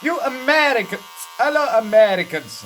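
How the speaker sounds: hammed-up eager salesman greeting Americans; car rental guy. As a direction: shout a delighted greeting at the tourists. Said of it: "He said".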